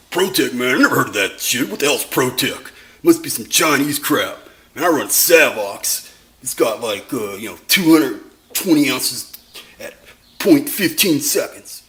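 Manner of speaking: loud, dopey blowhard voice; dumb guy voice. Retold or imitated. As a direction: play a know-it-all dope, loud. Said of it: "my dumb guy voice".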